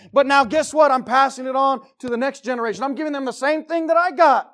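high, loud pulpit preaching; impassioned sermon at full volume; full-force preaching. Real, not acted. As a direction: preach it hard, pitched well up and loud.

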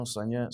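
signet said as "sign-yet"; unsure pronunciation. Shown as a demonstration. Reader asking how to say it, signet as "sign-yet". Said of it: "How do you say that?".

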